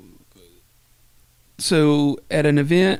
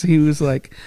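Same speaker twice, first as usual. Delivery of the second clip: usual speaking voice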